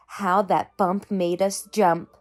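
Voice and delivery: ordinary delivery, nothing marked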